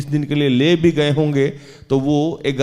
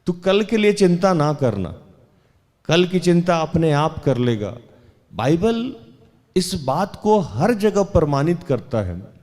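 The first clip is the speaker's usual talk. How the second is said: higher-pitched, insistent sermon delivery; raised, assertive preacher's voice; emphatic preaching; 4 semitones higher than usual. Real, not acted. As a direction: drive the promise home to the congregation.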